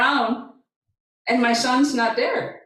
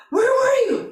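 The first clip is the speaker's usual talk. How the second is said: imitating a distraught son, high-pitched; high, urgent retold question; upset demand.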